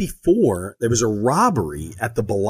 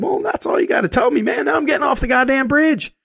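much higher, startled and hurried; alarmed; 3 dB louder than usual. Retold or imitated. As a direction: jolt up in pitch, suddenly spooked. Sudden alarm at active shooter.

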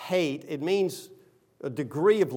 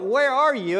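impatient call; 9 semitones higher, 6 dB louder than usual. Retold or imitated. Host calls the late guest.

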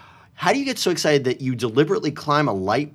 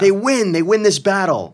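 higher, louder triumphant cheer; excited cheering; 9 semitones higher, 6 dB louder than usual. Pretend. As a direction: celebrate the win. Cheering for team win.

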